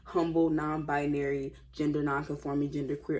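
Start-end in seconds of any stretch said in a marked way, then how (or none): none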